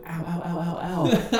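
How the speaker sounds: a young wrestler faking injury mid-match, hammed-up ows; fake pained cries. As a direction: ham up getting hurt to fool an opponent. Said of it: "I did a lot of fake crying".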